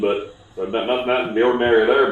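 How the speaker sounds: normal talking voice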